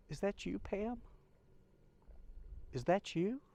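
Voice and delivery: high, rising, wondering plea; wondering question. Retold or imitated. Widower silently asking if stranger is his late wife, high wondering voice. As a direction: ask it upward, as if hardly daring to believe.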